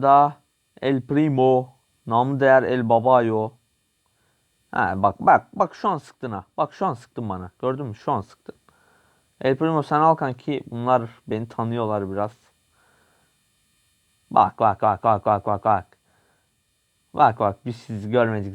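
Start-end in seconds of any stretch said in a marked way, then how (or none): none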